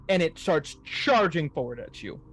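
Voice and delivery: ordinary delivery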